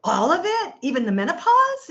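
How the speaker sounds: loud, high, astonished question; incredulous. Retold or imitated. Patient hearing menopause can be reversed, loudly incredulous. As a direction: echo it back in sheer disbelief. She repeats it in disbelief.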